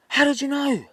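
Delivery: high, loud shocked demand. Acted. Old wife caught lying to husband, shocked. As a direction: blurt it out, voice shooting up. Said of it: "And she's shocked".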